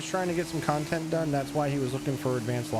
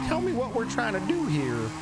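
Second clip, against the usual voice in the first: higher-pitched southern twang; southern accent; 7 semitones higher than usual. Retold or imitated. Streamer imitates his old character in early streams, southern accent. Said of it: "I was southern".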